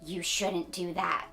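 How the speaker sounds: usual speaking voice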